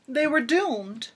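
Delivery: usual speaking voice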